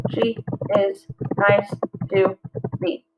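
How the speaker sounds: slow, each syllable spaced out; slow syllable-counting read. Shown as a demonstration. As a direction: clap out each beat. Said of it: "That's five".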